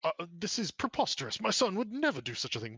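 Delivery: a lord outraged at the charge against his son; indignant lord. Acted.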